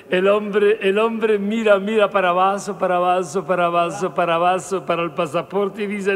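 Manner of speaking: normal talking voice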